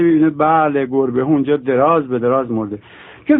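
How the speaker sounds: ordinary talk, nothing marked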